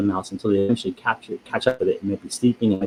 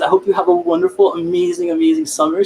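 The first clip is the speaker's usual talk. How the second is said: very high, effusive good wishes; gushing enthusiasm; 3 dB louder than usual. Real, not acted. Host signing off to viewers for summer, gushing. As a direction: soar up high and gush. Warm sign-off wishing viewers well.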